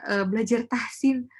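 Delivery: normal talking voice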